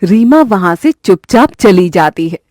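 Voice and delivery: plain reading voice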